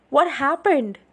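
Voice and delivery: high, very loud demand; yell. A narrator's reading. Charlie follows Bella downstairs, yelling. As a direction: yell the question, pitched well up. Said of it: "he yelled".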